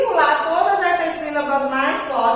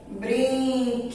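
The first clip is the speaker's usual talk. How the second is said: "Brinquedos" softly split: brin, que, dos; syllable by syllable; 2 semitones lower, 7 dB quieter, slower than usual. Shown as a demonstration. Teacher dictating to children as they copy, syllable by syllable. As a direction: dictate "Brinquedos" slowly with gaps between syllables.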